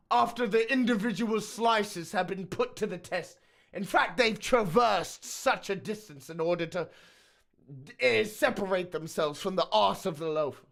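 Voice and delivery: mock-grand English author voice; hammed-up literary British narrator; Lord of the Rings author. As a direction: narrate it like an epic fantasy saga. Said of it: "a Lord of the Rings author".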